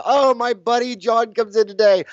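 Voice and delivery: light and glad, pleased the friend is coming; cheerful quote. Retold or imitated. Reading the schedule and pleased his buddy is coming.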